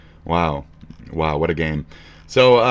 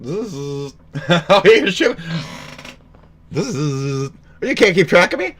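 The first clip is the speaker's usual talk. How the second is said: higher, mocking sing-song taunts; taunting a Dalek; 7 semitones higher than usual. Vlogger mocking a Dalek that can't hit him, high taunting. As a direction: goad the robot for missing.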